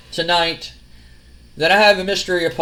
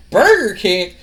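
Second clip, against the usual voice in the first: louder, higher disbelieving repeat; incredulous echo; 3 semitones higher, 6 dB louder, slower than usual. Retold or imitated. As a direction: echo it back like it's absurd. Stephanie echoes in disbelief.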